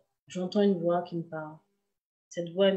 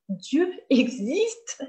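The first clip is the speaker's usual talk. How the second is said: higher, slow, swelling with emotion; overwhelmed exclamation; 5 semitones higher, much slower than usual. Retold or imitated. She realizes God exists, voice rising, overwhelmed. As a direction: say it as the truth breaks over you.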